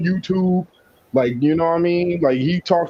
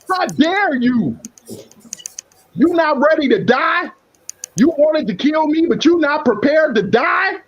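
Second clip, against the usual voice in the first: indignant anger; 8 semitones higher than usual. Real, not acted. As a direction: confront him with contempt. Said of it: "Now I'm mad".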